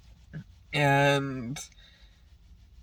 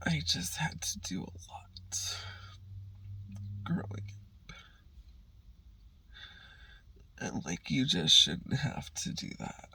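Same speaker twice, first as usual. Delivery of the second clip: very soft, voiceless sadness; hurt, near whisper; 12 dB quieter than usual. Real, not acted. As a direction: barely get the words out. Upset recalling hard childhood.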